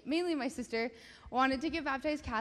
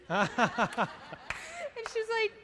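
laughing; 11 semitones higher, 6 dB louder, much slower than usual. Real, not acted. Laughing while retelling the story.

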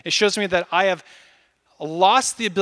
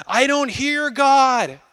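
higher, very slow whining; mock complaint; 6 semitones higher, 4 dB louder, much slower than usual. Preacher mimicking someone in crisis, slow whiny complaint. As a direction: stretch it out like a frustrated believer. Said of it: "you're like".

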